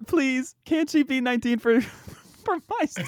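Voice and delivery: high-pitched, hammed-up begging; mock pleading. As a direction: beg playfully, breaking into laughter. Comic pleading about her age.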